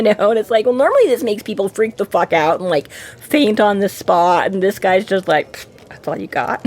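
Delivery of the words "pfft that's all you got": soft, low, imitated scoff; mock dismissive scoff. Retelling how the man shrugs off Harry's power, dismissive scoff. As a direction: puff it out under the breath, unimpressed. Mimics the man's scornful dismissal.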